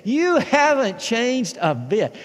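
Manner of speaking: high, loud gushing compliment; loud flattering quote. Retold or imitated. Church members flattering the returning pastor, loud. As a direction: gush it to the congregation. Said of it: "That's what they said".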